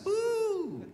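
squeaky "ooh" way up high; high wincing ooh. Real, not acted. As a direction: wince at your own words. Reaction to own blunt point.

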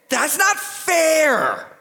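put-on high, drawn-out childish protest; whining four-year-old. People taking digs at God, whining like a four-year-old. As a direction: stretch it out like a sulking little kid. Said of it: "kind of doing the, the two, the, the four-year-old kid".

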